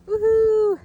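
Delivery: loud high whoop of triumph; excited cheer. Real, not acted. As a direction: celebrate at the summit. Reaching the mountain top.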